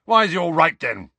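high, loud, blustering challenge. A narrator's reading. Uncle challenging nephew's story, blustering. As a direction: bluster out the question. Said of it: "blustered Uncle Vernon".

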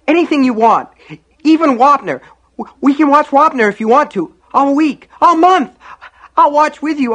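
put-on high, loud child in hysterical panic; wailing little brother begging; frantic pleading. A narrator's reading. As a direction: bargain desperately, at the top of your voice. Terrified boy bargains for brother's life.

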